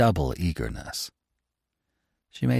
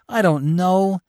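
anguished cry; 12 semitones higher, 7 dB louder, slower than usual. Acted. Repeated, second cried out.

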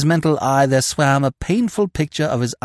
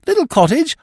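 high, louder puzzled question; baffled echo; 6 dB louder than usual. A narrator's reading. Freddy knows nothing of a cottage, baffled echo. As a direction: echo it blankly, pitched up.

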